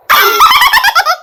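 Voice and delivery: high, far-off cackling laugh; old woman's cackle. Acted. Old grandma cackling at the family's guesses. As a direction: cackle like a sly old granny. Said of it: "நீ என்ன கிழவி சிரிக்க?".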